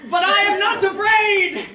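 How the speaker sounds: loud, high protest of courage; defiant shout. Acted. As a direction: cry it out to the crowd. Condemned man protests his courage.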